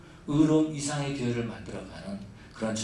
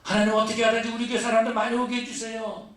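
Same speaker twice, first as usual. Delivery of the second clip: mock selfish prayer; 8 semitones higher, 5 dB louder than usual. Mocking a selfish church's prayer.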